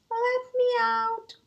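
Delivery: high, slow, thin muffled plea; tiny faraway voice. Acted. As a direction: plead small and far away. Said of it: "the tiny faraway voice".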